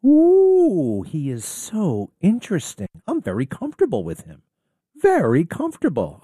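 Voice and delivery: hammed-up gushing fan voice. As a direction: swoon over him like an impressed liberal. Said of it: "It's, uh".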